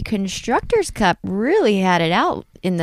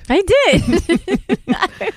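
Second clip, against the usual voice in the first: excited; 11 semitones higher, 4 dB louder than usual. Real, not acted. Proud, excited reply.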